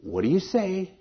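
high-pitched imitation of a parent coaxing; mock parental prompt, lifted high. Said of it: "that common thing".

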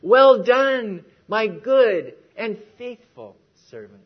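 slow, ringing proclamation of praise; quoted commendation. Retold or imitated. Preacher quoting the Lord's words to the congregation, slow proclamation.

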